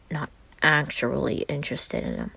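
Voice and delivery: usual speaking voice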